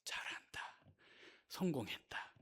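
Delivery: hushed aside in the devil's voice; gloating devil. Acted. Satan watching believers fight, quietly gloating. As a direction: whisper approval slyly from the side. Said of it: "사단이 옆에서 뭐라 그러냐면".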